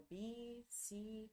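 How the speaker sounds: BICICLETA split slowly into its syllables, with pauses. Shown as a demonstration. Teacher counting syllables in bicicleta, slow and separated. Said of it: "Quantas sílabas tem a bicicleta?".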